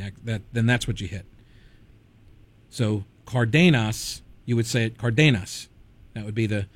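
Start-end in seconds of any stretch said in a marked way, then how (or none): 3.2-4.2 Cardenas slowly as car-DAY-nas; stress on second-to-last syllable. Shown as a demonstration. Host explaining Spanish stress rule, Cardenas stressed on the second-to-last syllable. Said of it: "emphasis on the second to last syllable". 5.0-5.7 Cardenas as car-DAY-nas; stress on second-to-last syllable. Shown as a demonstration. Host showing how Spanish would say it, Cardenas stressed on DAY. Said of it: "emphasis on the second to last syllable".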